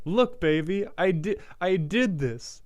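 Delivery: put-on high, loud little-kid show-and-tell; proud child. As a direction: show off like a child with macaroni art. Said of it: "like a child with macaroni art".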